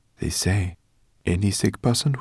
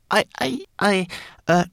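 nervous stammer; 4 dB louder than usual. Acted. Twigleg stammers, caught out.

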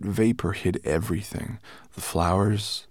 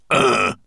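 loud dwarf's groan; 3 semitones higher, 7 dB louder than usual. A narrator's reading. Dwarf collapsing after losing his hat, groaning. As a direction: groan heavily as you sit down.

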